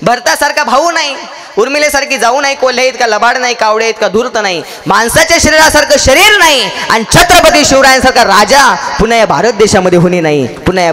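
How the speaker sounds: high-pitched litany, each phrase falling away; rousing preaching. Real, not acted. Preacher extols India and its heroes to the congregation, rousing.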